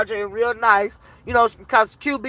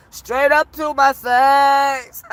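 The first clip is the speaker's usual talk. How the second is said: drawn-out, slow mock outrage; mock indignation; much slower than usual. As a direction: stretch every word in disbelief.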